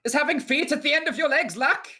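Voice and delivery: very high-pitched lyric quote; mock song vocal. Bandmate reciting odd lyrics to friends, in a high mock-vocalist voice.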